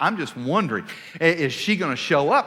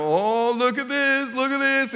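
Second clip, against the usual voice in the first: higher-pitched taunting sing-out; mocking; 7 semitones higher than usual. Two guys making fun of a couple, mocking. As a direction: jeer like a bully making fun. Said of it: "kinda mocking us and making fun".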